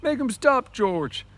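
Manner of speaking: high, loud terrified cry for help; frightened cry. Acted. Lenny calls to George while beaten, frightened cry. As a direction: cry out like a scared child. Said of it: "He cried".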